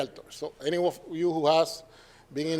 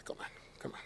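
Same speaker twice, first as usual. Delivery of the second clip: exasperated murmur; 23 dB quieter, much faster than usual. Retold or imitated. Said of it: "And I say".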